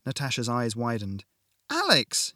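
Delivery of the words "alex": high, loud cry of a name; panicked shout. A narrator's reading. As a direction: scream his name as he falls. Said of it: "she shouted".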